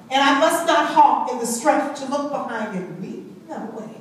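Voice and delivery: normal talking voice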